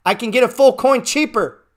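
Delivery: high, loud burst of delight; acted-out thrill at a cheap buy; excited opportunist. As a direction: pounce on a bargain, voice jumping up. Acts out gleeful opportunist buyer.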